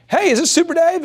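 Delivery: high, casual hello; easy buddy hello on the phone; friendly phone greeting. Shown as a demonstration. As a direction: greet him like an old teammate. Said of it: "I'm like".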